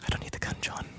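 breathy whisper. Acted. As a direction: whisper it secretly. Movie quote whispered.

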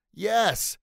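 high, punchy cheer; triumphant yes. Acted. Father and son think they have solved it, triumphant.

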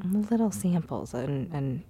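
trailing off, losing the thread; stoned. Real, not acted. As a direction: drift off mid-sentence, stoned. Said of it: "I'm very stoned".

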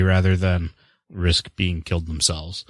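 ordinary talk

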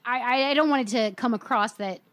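ordinary talk

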